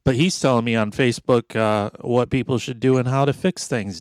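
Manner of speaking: ordinary talk, nothing marked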